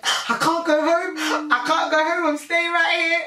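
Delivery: high, loud, drawn-out wail; hammed-up desperate pleading; mock tearful panic. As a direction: act out bursting into tears. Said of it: "I just burst into tears".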